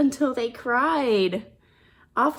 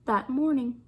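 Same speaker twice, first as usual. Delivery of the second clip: ordinary delivery, nothing marked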